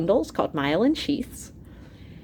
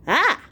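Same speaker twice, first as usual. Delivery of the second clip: startled cry; 9 semitones higher, 4 dB louder than usual. Acted. Emergency startles.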